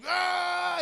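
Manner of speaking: high, loud held "ah"; mock battle yell. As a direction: yell as if about to strike. Mimics Windu's battle cry.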